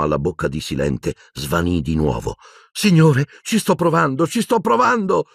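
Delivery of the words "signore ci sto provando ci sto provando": high, climbing, frantic shout; desperate cry. A narrator's reading. Boy failing to give his professor water, desperate cry. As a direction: cry out helplessly to him. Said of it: "gridò disperato".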